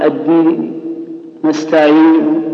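الدين and نستعين ending on a faint partial vowel; rawm ending. Shown as a demonstration. Teacher showing students rawm, faint last vowel on نستعين. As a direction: whisk a trace of final vowel onto الدين and نستعين. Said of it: "المعروف بالروم".

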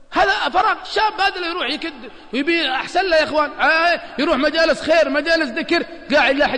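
rally them toward the good. Real, not acted. Preacher urging youth. (حث وعظي بصوت مرتفع)

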